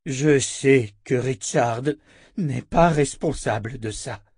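very slow, deliberate delivery; measured and grave; solemn elder. Acted. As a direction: weigh each word as you clear someone of blame. Elder clears Richard of blame.

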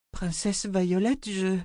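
high, slow, halting; start, then falter in awe; hesitant girl. A narrator's reading. Girl stammers, stunned.

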